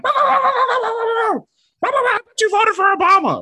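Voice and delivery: high, loud, quick yapping words; barking dog voice. Retold or imitated. Voicing what the office dog says, high loud yapping. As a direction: yap it out like an outraged dog. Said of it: "or whatever the dog is saying".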